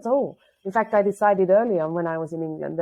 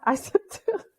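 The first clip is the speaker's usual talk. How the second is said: laughing; 11 semitones higher than usual. Real, not acted.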